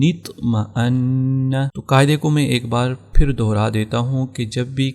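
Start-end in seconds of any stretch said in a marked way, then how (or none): 0.0-1.5 very slow, drawn-out اطمأن in a recitation tone; recitation demo. Shown as a demonstration. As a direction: stretch اطمأن slowly, linking it with the kasra nun before it. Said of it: "تو ہو جائے گا خیرن".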